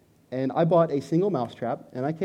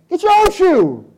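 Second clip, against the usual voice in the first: high, loud, slow mock retort; retelling wife's retort in a high loud mock voice; mock wife shout; 10 dB louder, slower than usual. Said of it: "And she said".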